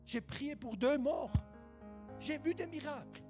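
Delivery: loud fervent preaching. Real, not acted. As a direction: preach it out at full force. Preaching at full force.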